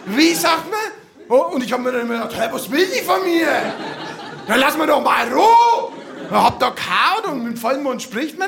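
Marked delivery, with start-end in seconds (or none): 0.1-0.8 mother prompting. Retold or imitated. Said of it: "Die eigene Mutter". 2.4-5.9 put-on annoyed kid thought. Boy's annoyed inner reply.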